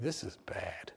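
hushed realization to yourself; whispered dread. Real, not acted. Son in phone booth thinking to himself, whispered. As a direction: whisper it, soft and low.

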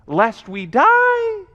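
high, slow, drawn-out alarm; acted dread. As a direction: stretch it out in mock terror. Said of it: "They're afraid of God".

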